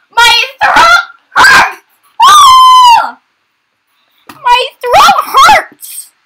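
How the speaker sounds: very high, loud, slow strained cry; cracking yell. Real, not acted. Complaining to the viewers that the voice cracked, strained screech. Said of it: "I had to voice crack".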